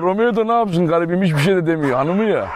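ordinary talk, nothing marked

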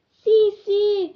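high, loud joyful yes; tiny voices bursting with consent; happy squeal. A narrator's reading. Little flowers say yes to bees, delighted. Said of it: "risposero i fiorellini felici".

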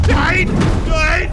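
high shrieking no; panicked scream. Real, not acted. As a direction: scream in terror. Screams at monster.

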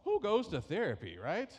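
loud, high disbelieving aside; who would ever do that; incredulous. Real, not acted. Pastor mocking his own pride to the congregation, incredulous.

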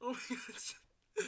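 high, slow gasp through real laughter; breathless laughing. Real, not acted. Actor worn out by laughing fit, breathless laughing. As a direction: squeeze it out while laughing.

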